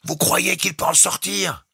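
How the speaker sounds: high, slow shouted question; cried question. A narrator's reading. Jukes cries out to the captain over the storm. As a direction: shout it into the wind. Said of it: "cria-t-il".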